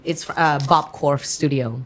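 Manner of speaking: ordinary delivery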